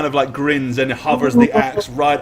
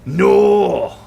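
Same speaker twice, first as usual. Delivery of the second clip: louder, higher bitter grievance, slow at first; bitter rant; 4 semitones higher, 5 dB louder, slower than usual. Acted. Prisoner ranting to his captors about a lost title, bitter anger. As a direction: spit out the lost title with resentment. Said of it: "He said".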